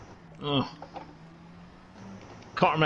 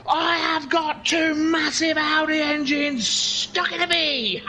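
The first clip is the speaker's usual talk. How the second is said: high, drawn-out mock shout; 8 semitones higher, slower than usual. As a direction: shout it high and slowly, hammed up. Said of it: "it's shouting at you".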